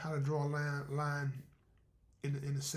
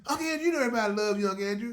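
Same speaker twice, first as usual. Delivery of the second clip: quick, higher, sweet-talking niece; 7 semitones higher, 10 dB louder, faster than usual. Acted. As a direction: flatter him fast like a coaxing girl. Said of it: "My niece cornered me and said".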